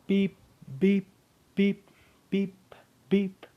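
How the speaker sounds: high, loud mouth beeps like a system chime; imitated beeps. Narrator sounds the system alert pulling the hero back with loud beeps. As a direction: beep it out like a game alarm.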